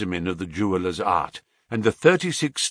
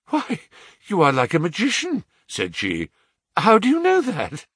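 higher, startled and quick; startled young woman; 9 semitones higher than usual. Acted. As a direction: caught off guard by his guess. Mary startled by Holmes.

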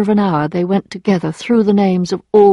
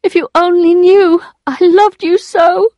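high, pleading declaration; anguished longing; 11 semitones higher, 5 dB louder than usual. A narrator's reading. Emma declares her love to Rodolphe, anguished longing. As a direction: pour it out, pitched right up.

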